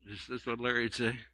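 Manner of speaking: ordinary delivery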